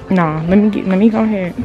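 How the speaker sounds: normal talking voice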